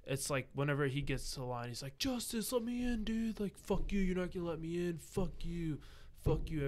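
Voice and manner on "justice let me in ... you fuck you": high-pitched mock outrage from the queue; imitated angry tirade. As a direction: whine and curse like someone refused entry. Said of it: "Everything flips, and then when he's in".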